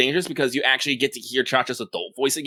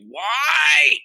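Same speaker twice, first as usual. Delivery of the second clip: long, high, stretched-out why; drawn-out whine; 14 semitones higher, 4 dB louder than usual. Real, not acted. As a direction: whine it like you've been wronged. Complaining about sad Valentine scenes.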